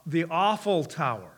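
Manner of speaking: "Eiffel" turned into "awful", as a pun; punning mispronunciation. Real, not acted. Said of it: "I call it the Eiffel Tower".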